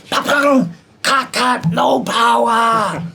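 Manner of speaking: high, loud yell, stretching the last words out; explosive hit noises into a drawn-out shout; re-enacted shouting. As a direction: imitate the master yelling a halt to the take. Re-enacts failed take and master's shout.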